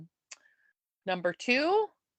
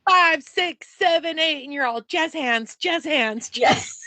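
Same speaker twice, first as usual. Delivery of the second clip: hammed-up showbiz count-in, higher and louder; mock dance count; 5 semitones higher, 5 dB louder than usual. Mimicking a stage dance routine to co-host, loud mock count. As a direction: call the count like a pushy dance captain.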